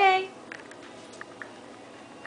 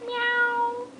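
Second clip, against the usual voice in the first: cat meow; 9 semitones higher, 4 dB quieter than usual. Shown as a demonstration. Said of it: "Kitty cat says".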